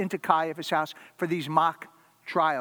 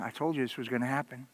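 soft Jesus voice; 5 semitones lower, 6 dB quieter, faster than usual. Acted.

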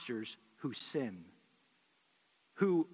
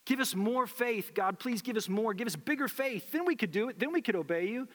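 pleading apostles; 7 semitones higher than usual. Retold or imitated. Pastor voicing apostles begging Jesus, high pleading. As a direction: beg for more faith like desperate disciples.

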